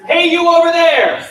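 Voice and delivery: big, high projected holler; loud, thick chest-voice shout; big call-out. Shown as a demonstration. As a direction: call across the room, nice and big. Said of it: "Nice and big".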